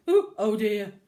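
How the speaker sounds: loud squeaky little mouse voice; mice saying oh dear. Retold or imitated. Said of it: "the little mice kept going".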